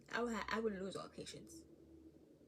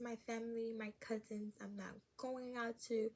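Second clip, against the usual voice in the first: usual speaking voice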